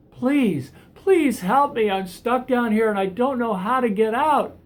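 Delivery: high, loud, frantic begging up from a pit; yelling up out of a hole for rescue; desperate loud plea. Acted. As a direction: shout up for help, desperate. Begs the therapist excitedly.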